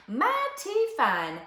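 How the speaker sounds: high, slow, drawn-out satisfaction; sighed contentment. A narrator's reading. Dusty Locks settles in the feather bed, contented. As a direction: savour it slowly, up high. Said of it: "sighed Dusty Locks".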